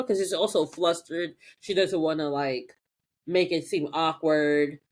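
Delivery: normal talking voice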